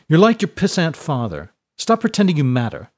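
high, loud scornful jab; contemptuous bully. A narrator's reading. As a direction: put the rival in his place. Bully insults the held boy.

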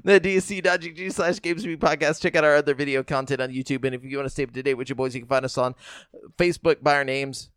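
brisk host sign-off read; podcast outro. Acted. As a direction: read the plug like an announcer.

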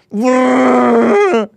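long high shouted wookiee yowl; a held, hammed-up wookiee bellow; a loud wookiee yell dragged out; Chewbacca roar. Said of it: "That's your Chewbacca".

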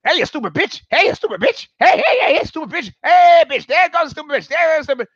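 high, frantic parrot squawks; high-pitched abusive bird voice; heckling parrot. Acted. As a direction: squawk insults like a parrot with a grudge. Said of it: "parrot's got it out for the landlady".

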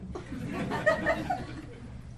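airy rising laugh; breathy laugh. Real, not acted. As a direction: let out a soft breathless laugh. Laughs after quote.

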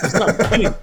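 normal talking voice

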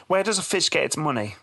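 sing-song joke delivery, slow high punchline; child reciting a joke. Retold or imitated. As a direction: recite a joke as a proud small kid would. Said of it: "he actually read it quite well".

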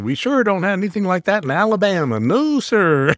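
imitated Alabama cowboy accent. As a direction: ham up a Southern tourist from Alabama. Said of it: "this accent is the one that".

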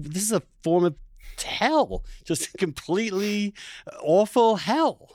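high-pitched, half-laughing disbelief; mock horror. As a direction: recall the misery with a laugh, voice jumping up. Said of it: "I thought".

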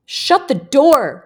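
loud, high, slow yell; yelled order. A narrator's reading. One boy to another, yelled order. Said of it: "one yelled".

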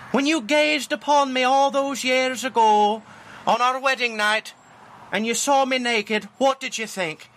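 high-pitched Scottish wife; Scottish accent. Acted. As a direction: reminisce as a hammed-up Scottish bride. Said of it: "he does it in a Scottish accent".

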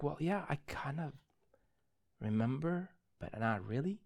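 very soft, halting answer; subdued, tentative retold voice; imitated hesitant reply. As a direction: answer quietly, unsure, with pauses. Calmed boy's hesitant reply, retold.

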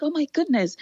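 high, bright burst of surprise; a retold delighted oh my goodness; surprised exclaim. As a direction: gasp it out like sudden good news. Rabbi's excited reply, retold.

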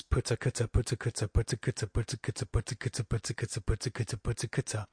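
"putta kutta" as an even, repeated drill; steady, monotone tongue-twister on putta kutta; articulation exercise. Shown as a demonstration. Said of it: "exercise with my students on, um, just on articulation".

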